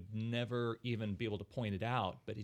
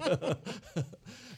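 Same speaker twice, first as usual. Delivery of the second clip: burst of laughter; 7 semitones higher than usual. Real, not acted. Laughing at own story.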